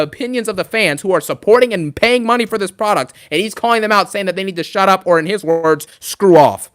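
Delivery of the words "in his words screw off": ordinary delivery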